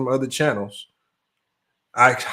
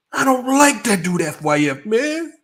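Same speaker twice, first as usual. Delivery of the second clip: high, wailing fake sob in the voice; hammed-up tearful complaint; mock crying; 12 semitones higher, 3 dB louder than usual. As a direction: whine it out like a man near tears. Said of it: "he started crying real tears".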